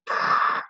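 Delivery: sharp voiceless burst of breath; exasperated huff. Retold or imitated. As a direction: imitate a blown-out huff. Imitates own flushed, angry reaction.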